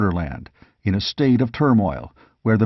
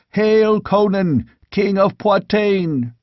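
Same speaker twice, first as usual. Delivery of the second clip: slow, much higher, proclaimed; ceremonial hail; 8 semitones higher, slower than usual. A narrator's reading. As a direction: draw out each word like a herald. Warriors hail their king.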